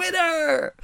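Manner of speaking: high, drawn-out victory call; mock triumph. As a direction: celebrate like a game-show win. Acts out hitting the toilet bowl.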